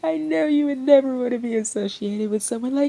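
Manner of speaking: very high, loud overlapping voices; frantic clamor. Acted. Crowd clamoring around Ren, shrill and loud. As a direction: pile on, all shouting at once.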